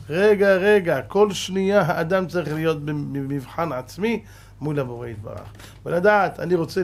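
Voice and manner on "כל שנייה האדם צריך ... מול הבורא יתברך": ordinary delivery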